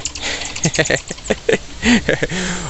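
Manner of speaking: a short high laugh at the monkeys; laugh, high and soft, amused by the fight. Real, not acted.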